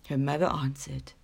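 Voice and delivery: ordinary delivery, nothing marked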